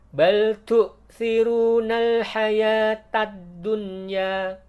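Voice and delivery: hayatad dunya with a voiced, unbreathy t; without hames. Shown as a demonstration. Teacher demonstrates the reading without hames. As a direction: chant it slowly, t not whispered. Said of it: "dengan cara yang tidak hames".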